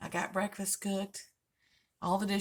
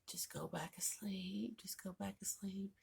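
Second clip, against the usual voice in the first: hushed whisper, slow and pleading; 12 dB quieter than usual. Retold or imitated. Whispering to her husband to go back to sleep. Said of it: "I was like".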